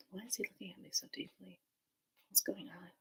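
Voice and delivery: very soft, quick whisper; acted whispered unease. Newcomer watched by the silent cacique, uneasy whisper. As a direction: whisper it like a nervous private thought.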